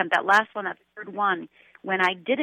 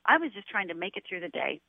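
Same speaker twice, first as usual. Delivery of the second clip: usual speaking voice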